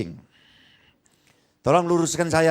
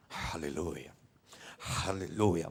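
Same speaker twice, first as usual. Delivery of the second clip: mock mumbled hallelujah; 12 semitones lower, 12 dB quieter, much slower than usual. Mocking dazed pastor murmuring.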